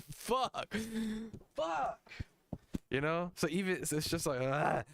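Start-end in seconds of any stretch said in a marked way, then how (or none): 0.1-2.1 high frustrated squeal. Retold or imitated. Retold frustration.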